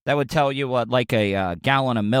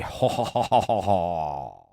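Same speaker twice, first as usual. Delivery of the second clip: low, slow hammed-up villain chuckle; mock evil laugh; 7 semitones lower, 4 dB quieter than usual. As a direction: gloat with a cartoon villain laugh. Imagined villain gloats.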